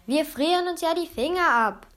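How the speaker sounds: high-pitched goblin complaint; indignant high character voice; goblins calling out. A narrator's reading. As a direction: whine it out as a grumpy call. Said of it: "riefen die Kobolde von drinnen".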